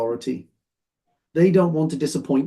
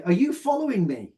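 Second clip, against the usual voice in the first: higher, startled and wary; frightened demand in a dark street; alarmed question; 6 semitones higher than usual. Shown as a demonstration. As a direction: ask it like someone's tailing you at midnight. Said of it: "tone of voice is important".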